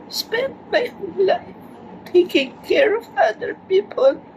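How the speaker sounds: tearful, choking up; crying. Real, not acted. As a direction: voice breaking into tears. Emotional birthday message to niece.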